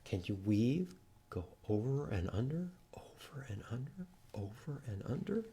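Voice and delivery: soft, fast whispered chant. Shown as a demonstration. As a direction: whisper the weaving rhythm. Guiding kids to weave.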